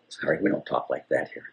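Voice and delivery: ordinary delivery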